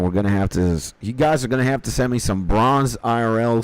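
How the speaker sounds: normal talking voice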